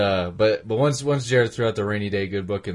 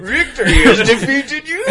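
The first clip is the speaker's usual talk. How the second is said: Bane voice; 12 semitones higher, 6 dB louder than usual. Retold or imitated. Joining the Bane quote bit.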